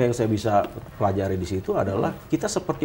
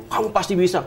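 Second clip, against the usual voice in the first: raised-pitch pep-talk line; imitating motivational speaker; 7 semitones higher than usual. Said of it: "banyak ahli motivasi yang bilang".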